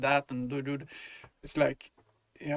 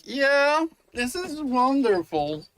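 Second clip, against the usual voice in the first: sarcastic; 10 semitones higher, 6 dB louder, slower than usual. Retold or imitated. Sarcastic reply to cop.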